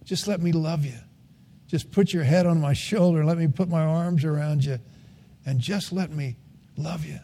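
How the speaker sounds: put-on God speaking tenderly to his children, softly; tender God's voice. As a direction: coax gently, like soothing a tired child. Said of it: "he's saying to us".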